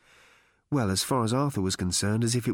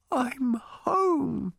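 higher, voice close to crying; tearful; 14 semitones higher than usual. A narrator's reading. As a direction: near tears with relief. Arthur close to tears.

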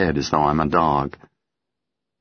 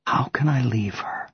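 whispered; 6 semitones higher, 4 dB quieter than usual. A narrator's reading. Narrator's private despair.

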